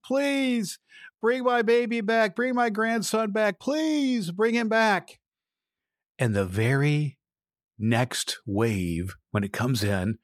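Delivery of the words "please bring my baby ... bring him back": high, slow pleading, hammed-up crying; wailing plea. Grandma begs the Lord to return her grandson, high mock wail. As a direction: beg heaven in a mock wail. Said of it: "starts crying and screaming".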